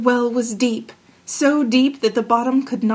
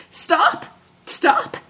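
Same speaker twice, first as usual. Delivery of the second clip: high-pitched calling frog; crying out; 7 semitones higher than usual. A narrator's reading. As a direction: call after her urgently in a high voice. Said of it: "cried the frog".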